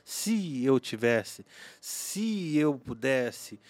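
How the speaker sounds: very slow, stretched what-ifs; drawn-out regret. Shown as a demonstration. Speaker illustrating how "if" kills you, slow drawn-out phrases. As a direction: linger on each "se".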